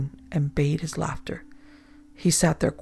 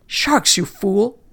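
high, loud scolding yell; captain yelling at a superstitious sailor; angry shout; 4 semitones higher, 6 dB louder, slower than usual. Acted. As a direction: bark it at the fool.

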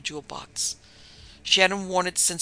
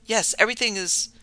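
higher, louder startled answer; hoarse cry; 6 semitones higher, 5 dB louder than usual. A narrator's reading. Doctor springing for the bed, crying out hoarsely. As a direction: cry out, pitched up and urgent. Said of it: "Doctor Guriah cried out hoarsely".

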